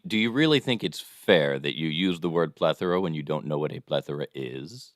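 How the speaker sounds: normal talking voice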